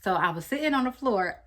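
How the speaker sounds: ordinary talk, nothing marked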